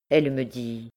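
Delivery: usual speaking voice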